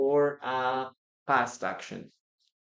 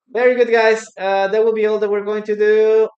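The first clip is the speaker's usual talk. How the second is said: loud upbeat wrap-up; 7 semitones higher, 11 dB louder, faster than usual. Real, not acted. Wrapping up the class.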